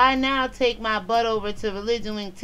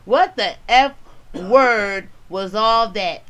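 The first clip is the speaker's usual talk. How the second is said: ordinary talk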